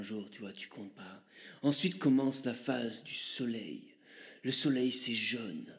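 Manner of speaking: low, hushed mystic guru; Chaman Chorizo. Acted. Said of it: "je vais te laisser avec, euh, Chaman Chorizo".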